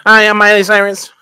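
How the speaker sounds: put-on a kid doing a robot voice; [name] robot voice. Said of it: "Then talks just like this".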